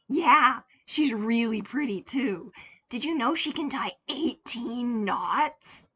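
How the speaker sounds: put-on childish babbling. Boy chattering to the cashier, eager childish babble. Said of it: "He babbled on".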